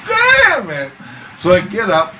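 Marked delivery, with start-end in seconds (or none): 0.1-0.9 high groaning curse; exasperated curse. Retold or imitated. Forced out of bed by the ghost, exasperated. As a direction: let it out, fed up. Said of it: "And I'm like".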